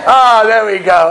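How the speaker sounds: amused slow chuckle through the words; laughing reaction. Retold or imitated. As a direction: laugh it out, tickled by the question. Rabbi retells his own reaction.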